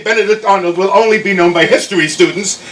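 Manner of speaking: normal talking voice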